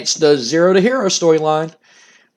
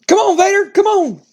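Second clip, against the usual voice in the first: shrill-high, much louder shout; Jim Cornette yelling; 4 dB louder than usual. Retold or imitated. Manager spotting his rival in the lot, high loud yell. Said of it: "Jim Cornette goes".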